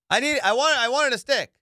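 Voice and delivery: high, loud burst of glee; can't hide how much he wanted it; excited outburst. Real, not acted. Real glee over the car.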